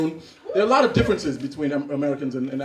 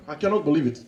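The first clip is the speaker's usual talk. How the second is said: lower, stunned African man; African accent; 5 semitones lower, 6 dB quieter than usual. Acted. Friend takes in the sad news, stunned African accent. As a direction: let disbelief sink in, African accent. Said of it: "Two Africans that haven't seen each other".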